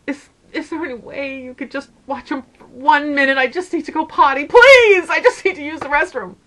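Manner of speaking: high, frantic begging, bursting out on "please"; acted desperate plea. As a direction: plead urgently, re-enacting a frazzled mom. Frazzled mother begs ladies for help.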